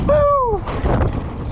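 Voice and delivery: high triumphant woo; excited whoop. Real, not acted. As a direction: cheer the finish. Whooping at the final drop.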